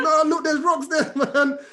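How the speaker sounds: high, loud, alarmed objection with a laugh; panicked protest. Retold or imitated. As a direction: point out the danger in mock panic. Acting out fear at seeing rocks.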